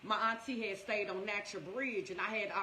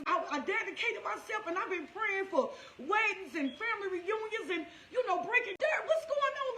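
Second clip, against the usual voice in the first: desperate plea; 8 semitones higher than usual. Retold or imitated. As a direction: beg God, half laughing, half crying. Pleading with God over deaths.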